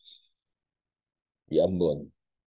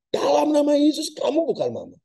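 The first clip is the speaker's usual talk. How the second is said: loud commanding rebuke in Jesus' name; rebuking shout; 5 dB louder than usual. Acted. The pastor shouts at the ghost posing as his mother. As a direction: bark it out like casting out a spirit. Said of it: "Dia bentak".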